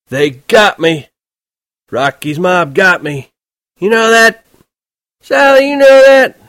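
put-on high, loud, slow drunken bellow; drunken shouting. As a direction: slur it loud into the phone, very drunk. Drunk Holden pretends wounded.

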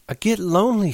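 high pleading woman's voice; Curly's wife, lonely. Acted. Curly's wife pleads her loneliness to Lenny. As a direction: beg him to listen to her.

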